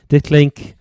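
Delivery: normal talking voice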